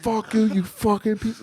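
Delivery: high-pitched retold outburst; losing it. Retelling losing it on the drunk guy, high mock-angry outburst. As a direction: imitate yourself losing it, pitch shooting way up. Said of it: "I fucking lose it on him".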